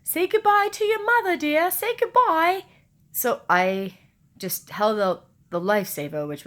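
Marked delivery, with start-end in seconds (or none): 0.0-2.6 high, loud grandmotherly call; insistent grandmother, pitched high and loud; voiced grandmother. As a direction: pipe up high as a grandmother urging a child. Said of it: "My grandmother kept saying".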